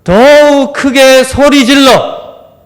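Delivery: high, loud, slow drawn-out cry. Retold or imitated. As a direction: call out with everything you have. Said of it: "이 사람이 그 다음에 어떻게 이야기해요".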